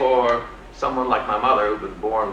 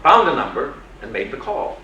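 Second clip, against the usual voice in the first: ordinary talk, nothing marked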